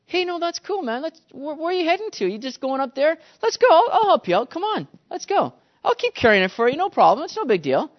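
high-pitched, breezy eager helper; eager volunteer. Acted. Walker cheerfully offers soldier more.

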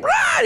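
high-pitched imitation of a raging little brother; quick, high "rah" of a kid losing it; hammed-up tantrum yell; mock rage roar. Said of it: "little brother just goes into a rage".

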